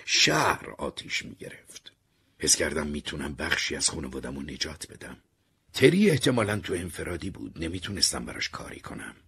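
usual speaking voice

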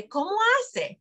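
put-on high, playful voice for a toddler; talking to a toddler. Shown as a demonstration. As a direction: ask it like coaxing a small child. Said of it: "we say".

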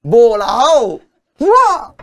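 loud, high burst of amazement; dramatic exclamation. Retold or imitated. Telling the dancer's fall, a loud high exclamation. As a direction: punch it out like a sound effect.

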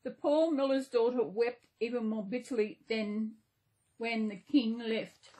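ordinary delivery

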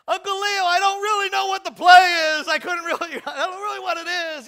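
high, loud panicked yell. Retold or imitated. Nephew Vince yells to Uncle Leo mid-play, loud and high. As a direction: yell it across the yard in a panic. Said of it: "he yells".

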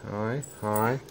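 ordinary talk, nothing marked

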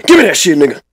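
high shouted demand; silly mismatched redub. Acted. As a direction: yell it up high. Shouted grab for banana.

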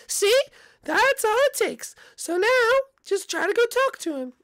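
calm your nervous son down gently; soothing mom voice. Acted.

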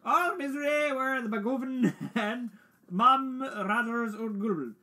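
theatrical verse reading, high and loud at first; poem recitation. Acted. Player reciting the Jabberwocky, hammed-up poem recitation. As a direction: declaim the nonsense poem grandly.

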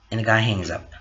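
ordinary delivery, nothing marked

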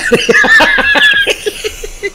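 high, airy burst of laughter. Real, not acted. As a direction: let a high laugh break out. Laughing at the nurse's worry.

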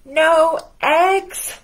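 high, loud shocked cry; dismayed cry. Acted. Farmer Brown hearing there are no eggs, loud cry. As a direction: cry out in alarm. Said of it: "cried Farmer Brown".

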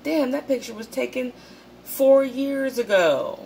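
high, stunned outburst; retelling the moment of discovering the photo, shocked and high; shocked disbelief. Retold or imitated. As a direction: react like you've just spotted your own mirror. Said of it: "I was like".

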